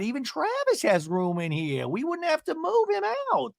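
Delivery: high, excited caricature voice; Gina gushing. Retold or imitated. Gina marvelling at her JetBlue pod, high mock gushing. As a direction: gush way up high, rising to a pleading squeal. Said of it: "And Gina goes".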